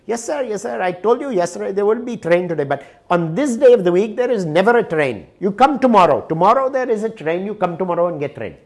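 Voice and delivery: put-on the clerk promising tomorrow's train in an Indian accent.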